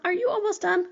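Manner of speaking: high-pitched voice for a pet; high, lightly pleading cat voice; impatient cat. Acted. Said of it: "my cat just joined, being like".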